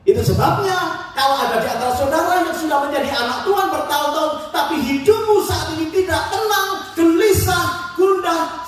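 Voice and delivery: high, loud preaching climax; preaching at full force. Real, not acted. Pastor warns restless believers, preaching loud and high. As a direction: build it up and drive it home.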